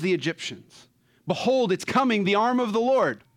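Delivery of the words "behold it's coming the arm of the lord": higher and louder, rousing; rousing proclamation. Real, not acted. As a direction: announce it with rising fervor. Climax of preaching.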